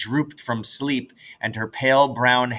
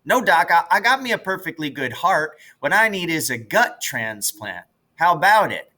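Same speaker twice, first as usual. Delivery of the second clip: a touch higher, playful plea to the doctor; mock patient; 6 semitones higher than usual. Jess joking to an imagined doctor, playfully pleading. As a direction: pretend to ask the doc.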